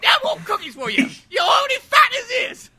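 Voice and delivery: high, hammed-up screeched scolding; mock scolding shout. Sherlock banning Watson's cookies, mock screeched scolding. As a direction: scold him in a high cartoon shriek.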